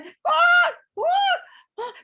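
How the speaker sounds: very high, squawking clucks that fall away; distressed clucking. Retold or imitated. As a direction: squawk like a hen being plucked. Chickens being plucked.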